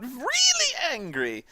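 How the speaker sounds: high, theatrical villain voice; mock-angry scenery chewing; hammy villain anger. Shown as a demonstration. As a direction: put on a high, over-acted angry villain. Voicing Travolta's angry villain manner.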